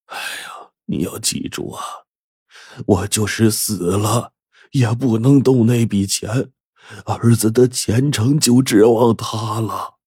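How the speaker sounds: faint sigh building into slow, strained plea; sighing, sorrowful. A narrator's reading. Sick husband begs wife to spare son's money, sorrowful. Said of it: "叹气说".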